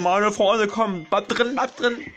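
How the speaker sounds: high, urgent begging; will the Pokéball to hold shut; tense pleading. Real, not acted. Streamer begs the Pokémon to stay in the ball, high and tense.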